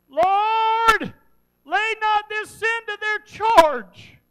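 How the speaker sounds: drawn-out, high, full-force shout; loud cry. Acted. Preacher as dying Stephen praying for his killers, huge loud cry. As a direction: cry it out slowly at the top of your voice. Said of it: "cried with a loud voice".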